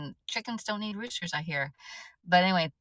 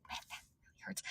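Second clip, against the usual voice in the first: breathy whispered inner thought of pain; 21 dB quieter, much faster than usual. Retold or imitated. Remembering the rooster's attack, whispered inner wince. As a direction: whisper it as if wincing. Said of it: "And I thought".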